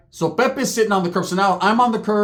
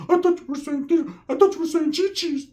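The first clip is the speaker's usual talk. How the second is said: voiced higher, cousin's mock voice; high put-on voice; 10 semitones higher than usual. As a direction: keep the squeaky cousin voice. [name]'s voice continues.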